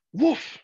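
short high playful woof; cheerful puppy woof; dog bark. Acted. Dog Manny barks.

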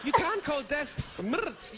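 slow words pushed through real laughter; can barely get it out for laughing; slowed, giggling disbelief. Real, not acted. Cracking up at the word.

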